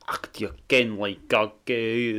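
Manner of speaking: mock broad Borders Scots, hushed; a quick hushed Borders Scots impression; Peebles Scots accent. Mimicking the Peebles accent for his friend, hushed Borders Scots. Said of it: "which is very much like".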